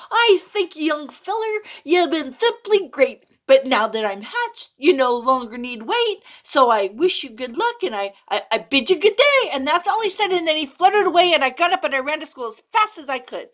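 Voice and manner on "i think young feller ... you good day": newly hatched bird. Acted. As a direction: chirp it out proudly as a fresh chick. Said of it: "it said with a yell".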